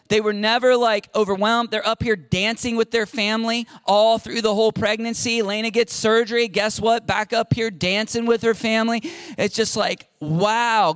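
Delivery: normal talking voice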